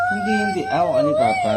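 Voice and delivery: crying out, high and loud; real crying. Real, not acted. Child crying during hospital procedure.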